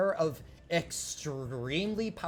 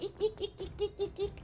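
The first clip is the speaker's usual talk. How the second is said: bat squeaks; faster than usual. Acted.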